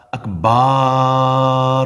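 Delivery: اکبار with the ba stretched into a long aa, slow; wrong long a. Shown as a demonstration. Teacher imitates a mispronunciation, drawn-out vowel. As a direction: drag out the ba of اکبار. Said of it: "با کے ساتھ الف مدہ لگا دیا".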